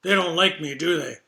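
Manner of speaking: put-on slurred, cheeky old woman voice; churlish teasing. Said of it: "in her best and churlish tease".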